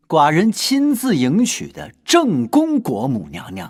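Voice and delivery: loud, high and drawn out; emphatic proclamation. Acted. King declaring the queen's rightful standing, emphatic. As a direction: proclaim it grandly, stretching the words.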